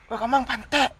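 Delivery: swearing at someone in anger; rude, harsh. Shown as a demonstration. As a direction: spit it out as a real insult. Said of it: "Ah, pasti kasar ya".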